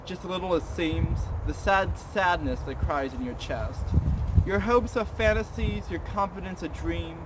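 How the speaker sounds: hushed, theatrical poem recital; dramatic poetry reading. Acted. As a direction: read it like a brooding recital. Second poem, same dramatic reading.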